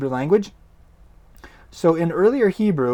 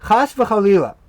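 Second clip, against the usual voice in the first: chas v'chalila said higher and louder; exclaimed; 6 semitones higher, 7 dB louder than usual. Shown as a demonstration. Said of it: "this doubled up expression".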